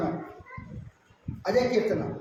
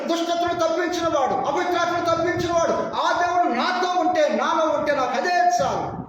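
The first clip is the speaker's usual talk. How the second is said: high, impassioned declaration; fervent preaching; 7 semitones higher than usual. Acted. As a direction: build testimony phrase by phrase, pitched high. Preaches in the woman's words. (ఆవేశంగా ప్రసంగం)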